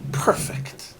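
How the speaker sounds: breathy whispered "Perfect."; whispered one-word answer; Tony's whispered reply. Retold or imitated. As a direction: hush it out like a satisfied secret. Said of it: "He said".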